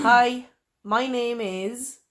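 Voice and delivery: drawn-out, hesitant hello; slow nervous greeting. Pretend. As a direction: stretch each word as you freeze up. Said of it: "You go".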